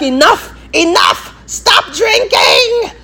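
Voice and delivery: high-pitched mock shout, drawn out; Akasha. Vampire queen to the vampires drinking her blood, high theatrical shout. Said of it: "she was like".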